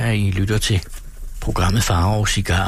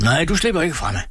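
higher, strained cry while wrestling; breathless shout; 9 semitones higher, faster than usual. Acted. Forester grappling with the thief in the woods, breathless high shout. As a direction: call out as you pin the thief down. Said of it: "råbte varulven forpustet".

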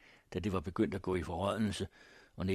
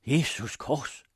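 raised, louder exclamation; startled shout; 6 semitones higher, 6 dB louder, slower than usual. A narrator's reading. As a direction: cry out at a grim find. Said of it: "da en af karlene råbte".

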